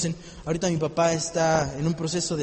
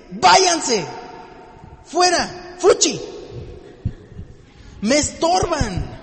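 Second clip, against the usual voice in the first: commanding Jesus; 6 semitones higher, much slower than usual. Acted. Jesus sends out the mourners.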